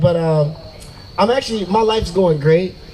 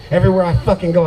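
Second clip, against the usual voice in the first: ordinary talk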